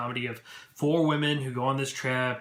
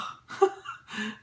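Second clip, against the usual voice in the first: high falling burst of laughter; real laugh; 8 semitones higher than usual. Real, not acted. Vlogger laughs at the reporter's blunder. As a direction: laugh out at the awkwardness.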